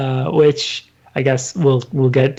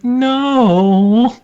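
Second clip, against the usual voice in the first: mock bully no; 7 semitones higher, 5 dB louder than usual. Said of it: "he's like".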